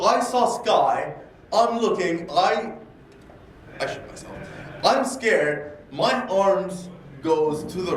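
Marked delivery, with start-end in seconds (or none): 3.8-4.3 whisper. Retold or imitated. Whispered admission of fear.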